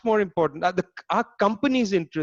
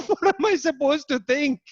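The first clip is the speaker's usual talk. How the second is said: mock incredulous, pitched way up; retelling the minister's baffled reply, pitched high; 11 semitones higher than usual. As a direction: imitate a baffled minister throwing up his hands. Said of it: "And Arezia was just like".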